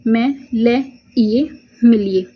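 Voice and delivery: मैं, ले, इए said as separate syllables, slowly, then मिलिए joined; teacher spells a word out slowly for the class to write. Shown as a demonstration. Teacher breaking मिलिए into syllables for steno students, slowly.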